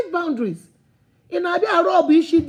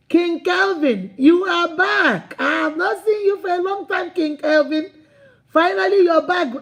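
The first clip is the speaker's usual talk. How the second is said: excited welcome; 4 semitones higher than usual. Real, not acted. Host greeting a long-absent viewer, excited and high. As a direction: stretch the name and cheer their return.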